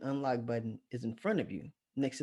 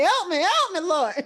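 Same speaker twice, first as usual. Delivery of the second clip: high, loud mock cry for help; hollering like a girl; 9 dB louder than usual. Storyteller re-enacts hanging out the window, hollering. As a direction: wail for rescue in comic panic. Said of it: "I'm hollering like a girl".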